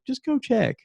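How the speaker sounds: high, insistent wife voice; wife insisting. Retold or imitated. Wife tells her husband to go check, high and insistent. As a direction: push him to just go. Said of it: "And she's like".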